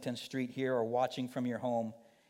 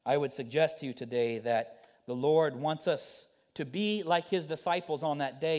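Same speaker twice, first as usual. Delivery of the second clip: ordinary talk, nothing marked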